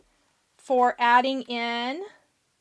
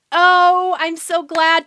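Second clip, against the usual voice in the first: excited; 5 semitones higher, 11 dB louder, slower than usual. Real, not acted. Delighted by viewer's comment.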